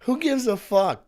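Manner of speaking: loud exasperated outburst; angry. Real, not acted. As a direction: yell it in disbelief.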